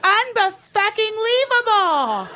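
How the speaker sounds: high, drawn-out mock disbelief; outraged friends. Imitating outraged girlfriends, high drawn-out indignation.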